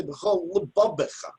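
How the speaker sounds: lebabecha with hard B sounds; wrong hard b. Shown as a demonstration. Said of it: "I can't say".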